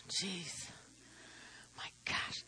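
breathless whisper to herself; under her breath, worn out by the heat; whispered aside. Real, not acted. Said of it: "I'm so hot".

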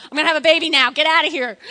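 retold urgent yell. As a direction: shout them out of the house. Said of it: "She's yelling at me".

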